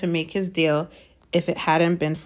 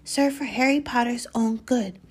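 whispering; 6 semitones higher than usual. Acted. Dobby whispers resolve.

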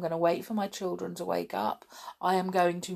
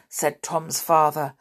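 plain reading voice

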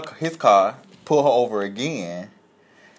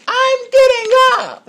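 loud, very high drawn-out mock scream; imitating shrieked defiance; 10 dB louder, slower than usual. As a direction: shriek your refusal as the stubborn woman. Repeats woman's hollered refusal.

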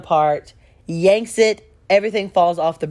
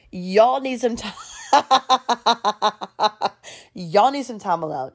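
cracking up mid-quote; laughing; 4 semitones higher than usual. Real, not acted. Friends insisting on time alone, teller laughing. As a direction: try to say it through real laughter.

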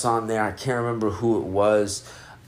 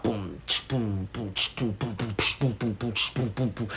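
beatboxed drums; 7 dB quieter than usual. Retold or imitated. Imitating drum pattern.

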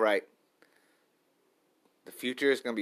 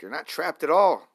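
emphatic self pep talk; 7 semitones higher, 9 dB louder than usual. Real, not acted.

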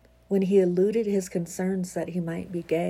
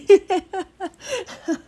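loud squealing laugh; high burst of laughter; 13 semitones higher, 8 dB louder than usual. Real, not acted. Laughing at own congested voice.

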